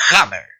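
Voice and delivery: "hammer" opened with a strong breathy h; "hammer" set apart in English. Shown as a demonstration. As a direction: model "hammer" with jota-like friction. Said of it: "en inglés se dice".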